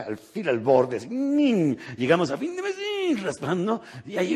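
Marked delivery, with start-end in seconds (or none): 1.1-1.8 squeaky scrape sound. Retold or imitated. 2.8-3.2 squeaky scrape sound. Retold or imitated. Scraping by at month end.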